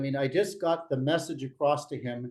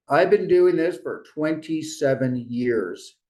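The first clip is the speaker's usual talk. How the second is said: slow, measured and a bit louder; slow, deliberate statement; 5 dB louder, slower than usual. Shown as a demonstration. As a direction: lay out each word slowly and firmly. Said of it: "but I say".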